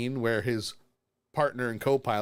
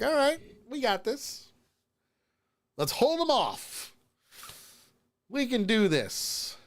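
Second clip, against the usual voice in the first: heroic high voice; 11 semitones higher than usual. Retold or imitated. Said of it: "they're like".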